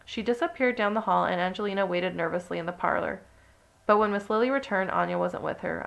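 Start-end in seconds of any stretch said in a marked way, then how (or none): none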